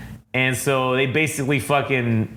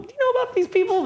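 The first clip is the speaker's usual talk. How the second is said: squeaky mock cop; much faster than usual. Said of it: "And they're like".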